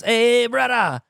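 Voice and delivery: loud, high Hawaiian-style greeting; attempted Hawaiian accent. Comic imitating a guy greeting him at a bar, attempted Hawaiian accent. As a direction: call out in a shaky-quality Hawaiian accent imitation. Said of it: "he's speaking in a Hawaiian accent".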